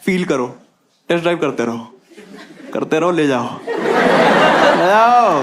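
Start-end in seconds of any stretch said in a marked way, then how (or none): 3.7-5.4 hammed-up cheer to take the car home; mock excited urging. Comic selling the dream, mock excited shout. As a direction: egg yourself on with a playful shout. Test drive pitch, hammed up.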